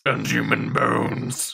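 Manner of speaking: creepy slow voice. Acted. Creepy witch voice line.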